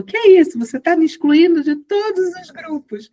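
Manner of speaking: high, loud opening in mock disbelief; startled protest. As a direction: imitate a friend protesting in surprise, pitched well up. Retold friend's startled protest.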